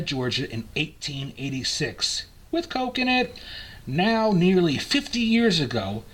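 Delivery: put-on grand, emphatic old-time ad read; promotional copy reading. Reads old promotional copy aloud.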